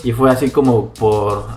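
ordinary delivery

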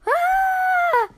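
very high, loud, held scream; long shrill yell of alarm; falling yell. A narrator's reading. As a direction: scream while plunging down a hole. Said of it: "she yelled".

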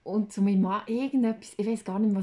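normal talking voice

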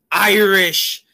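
ordinary delivery

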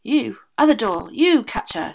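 loud screamed order; screaming aunt. A narrator's reading. Aunt screaming at the other door, loud order. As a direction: yell it at the second door. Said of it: "screamed the aunt".

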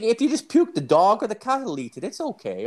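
lightly hammed-up Irish drinker voice; old Irish guy. Imitating Tommy the old Irish drinker, high-pitched. Tommy explains pets eat puke.

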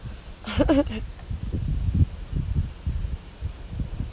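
soft airless laugh through tears; half laugh, half sob, no voice; a weary unvoiced chuckle; breathy tearful laugh. Real, not acted. Laughing while talking about tears.

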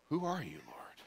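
breathless whispered question to the Lord; hushed and overwhelmed; awed whisper. Acted. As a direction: whisper it, stunned on your knees. Paul on the ground, awestruck.

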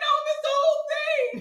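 high, loud whiny wail, laughing; mock wailing. Answering mama's offer, mock wailing. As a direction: wail like a child who lost everything. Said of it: "I was like".

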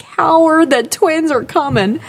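high cartoon mom calling out; lightly voiced pregnant cartoon mom; a hint of a cartoon mom announcing the twins; mom from Bobby's World. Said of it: "she's like".

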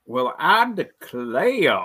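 slow, drawn-out Southern accent; hammed-up Southern gentleman drawl; Colonel Sanders. Shown as a demonstration. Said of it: "That sounded like, uh, Colonel Sanders".

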